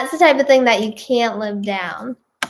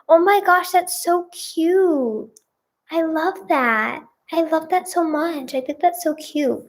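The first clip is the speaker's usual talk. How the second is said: cooing; 4 semitones higher than usual. Real, not acted. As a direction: coo it like it melts you. Gushing over a sweet story.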